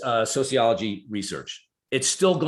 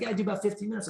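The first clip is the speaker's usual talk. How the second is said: put-on casual young man; 10 semitones higher, 3 dB quieter, much faster than usual.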